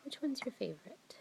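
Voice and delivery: whisper. Real, not acted. Whispered question to toddler.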